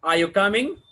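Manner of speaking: "Are you coming" loud, rising sharply on "coming"; yes-no question rise. Shown as a demonstration. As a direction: ask it, lifting "coming" at the end. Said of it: "These are the questions".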